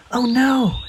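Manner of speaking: crying out in alarm. A narrator's reading. Peep crying out, late for the audition. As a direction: yelp it in sudden dismay. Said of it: "he cried".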